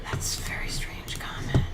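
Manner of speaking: unvoiced whispered aside. Real, not acted. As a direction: whisper it to herself, puzzled. Reading chat aloud.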